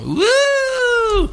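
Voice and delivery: long high wailing siren; drawn-out high siren whoop; imitated siren. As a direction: wail like a police siren. Imitates police siren behind him.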